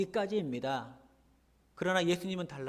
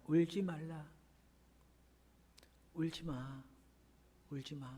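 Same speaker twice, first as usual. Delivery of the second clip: gently soothing, fading softer to almost nothing; tender comfort; 9 dB quieter than usual. Retold or imitated. Jesus gently comforting the grieving widow, softer and softer. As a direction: hush her tears softly, letting each line die away. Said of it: "얼마나 따스한 음성이었을까요".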